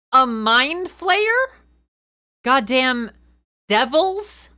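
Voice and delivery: high, loud disbelief; can't believe the rules allow it; incredulous. Real, not acted.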